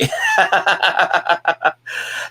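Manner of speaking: long run of giggly laughter; real laugh. Real, not acted. Streamer agreeing with chat that chocolate is delicious, laughing. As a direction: laugh it out, softening a little.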